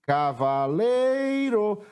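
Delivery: "Cavaleiro" called out, LEI long and high; chamando a palavra. Shown as a demonstration. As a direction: stretch and raise the LEI in "Cavaleiro". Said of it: "A sílaba que eu demorei mais tempo pronunciando foi o lei". ("Cavaleiro" com o LEI bem alongado e agudo)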